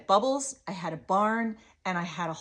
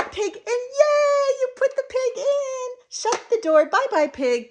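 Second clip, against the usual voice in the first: put-on high, loud celebration for a toddler; gasp, then cheer the child on with delight; squealing play-time hurrah; all excited; 9 dB louder than usual. Shown as a demonstration. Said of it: "because you got all excited".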